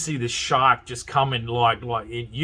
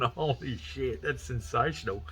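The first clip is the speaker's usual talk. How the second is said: hushed awe; 7 dB quieter than usual. Retold or imitated. Awed at the custom plate.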